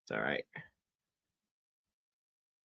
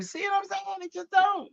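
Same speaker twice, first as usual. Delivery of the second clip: high playful aside. Pretend.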